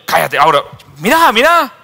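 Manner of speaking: high, loud excited cry; a bystander bursting with excitement; excited pointing. Acted. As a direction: urge him to look, thrilled.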